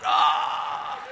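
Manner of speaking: breathless high squawk with no voice; mock bird battle cry; duck's challenge cry. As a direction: hiss out a defiant duck squawk. Imitates the duck puffing its chest.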